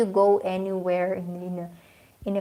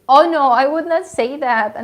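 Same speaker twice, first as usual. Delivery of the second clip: loud, higher-pitched protest; emphatic objection; 7 semitones higher, 12 dB louder than usual. Real, not acted. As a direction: burst out in real protest. Objecting to a suggested claim.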